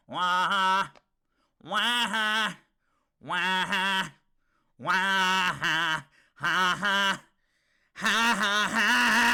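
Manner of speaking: loud sung wah-ha riff, rising; vocal guitar riff. Pretend. Streamer mimics the song's guitar riff, loud and sung. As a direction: belt the guitar part with your voice.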